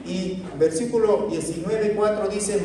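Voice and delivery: ordinary talk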